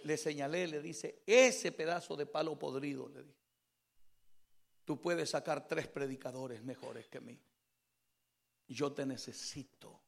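low, very soft, subdued testimony; testimonio íntimo. Retold or imitated. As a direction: recount a private prayer in a hushed, low voice. Subdued testimony of talking with God. (testimonio en voz baja, grave y recogida)

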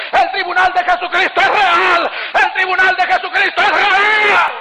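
high-pitched, fervent preacher's vow; pleading testimony at full pitch; impassioned preaching. Acted. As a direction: preach it urgently, driving up to a cry on each last word.

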